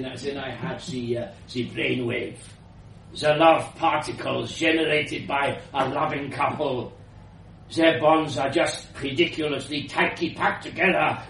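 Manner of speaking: put-on grand old inventor, slightly foreign; elderly foreign woman. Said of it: "elderly woman's voice".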